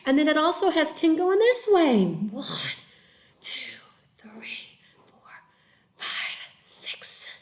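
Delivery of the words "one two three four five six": breathless whispered counting; puffing while counting; out of breath. Real, not acted. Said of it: "I'm huffing and puffing over here".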